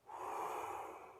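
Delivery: long audible breath out; slow demonstrated sigh; deep exhale. Shown as a demonstration. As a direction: model a calming breath for kids. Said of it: "I take a deep breath".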